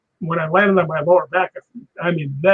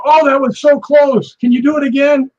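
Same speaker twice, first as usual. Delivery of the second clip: gushing director; 8 semitones higher, 6 dB louder than usual. Retold or imitated. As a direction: coax one more take like a fussy director. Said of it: "And the director go".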